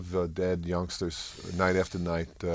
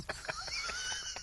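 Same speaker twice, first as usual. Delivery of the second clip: high burst of laughter; 12 dB quieter than usual. Real, not acted. Laughing at own punchline.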